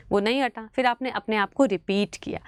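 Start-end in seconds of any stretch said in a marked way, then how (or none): none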